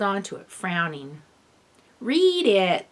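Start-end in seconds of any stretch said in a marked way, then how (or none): 2.0-2.9 insistent. A narrator's reading. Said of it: "the rest of the players insisted".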